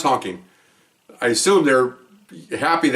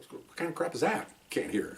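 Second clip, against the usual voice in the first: much softer, skeptical muttered thought; inner thought; 3 semitones higher, 15 dB quieter than usual. Retold or imitated. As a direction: quietly scoff to yourself. Inner thought during the arrest.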